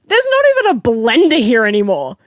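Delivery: loud, high-pitched incredulity; exasperated. Retold or imitated. Staff to customers wanting drinks after closing, loud and exasperated. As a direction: protest in exasperated disbelief.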